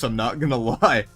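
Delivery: ordinary talk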